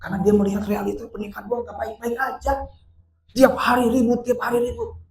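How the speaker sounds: high-pitched, distressed storytelling; impassioned preaching. Acted. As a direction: tell her troubles with rising urgency. Preacher tells story, raised pitch. (bercerita dengan nada tinggi mendesak)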